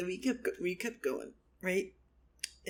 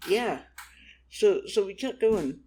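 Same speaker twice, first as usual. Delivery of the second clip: ordinary delivery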